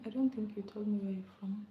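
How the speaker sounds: ordinary delivery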